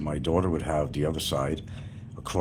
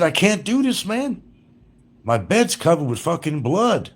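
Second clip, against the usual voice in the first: upset protest; 11 semitones higher, 4 dB louder than usual. Retold or imitated. Retelling telling her off about blood.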